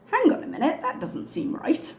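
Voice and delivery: high, doubtful hang on; skeptical surprise. Retold or imitated. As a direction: raise an eyebrow, pitch up. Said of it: "to say".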